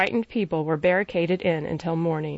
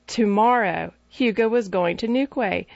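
usual speaking voice

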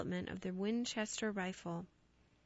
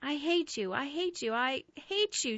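crying out at the sea; 4 semitones higher than usual. Acted. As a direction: rail at the sea through grief. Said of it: "she cried".

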